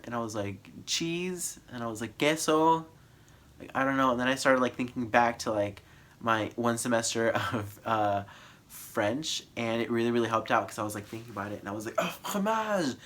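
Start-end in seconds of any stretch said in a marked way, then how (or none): 0.9-1.6 puzzled question. Retold or imitated. Clerk didn't understand. 2.2-2.9 hopeful question. Retold or imitated. Trying Spanish word on clerk. 12.0-13.0 high, bright eureka cry; light up as the word comes back; high-pitched eureka at remembering the French word; sudden realization. Retold or imitated.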